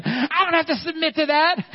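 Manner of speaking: high, loud mock-indignant wife voice. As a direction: squeak it out like an offended wife. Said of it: "it's Connie saying".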